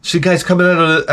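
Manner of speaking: ordinary delivery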